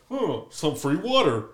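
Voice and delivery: play-acted lucky find; mock casual discovery. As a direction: act pleasantly surprised by a freebie, slowly. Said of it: "be like".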